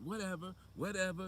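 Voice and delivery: high, dismissive mock-teen brush-off; unruly talk-show kid. Unruly Maury kid dismissing everyone, high and defiant. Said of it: "They be throwing up gang signs".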